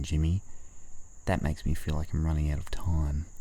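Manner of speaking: ordinary talk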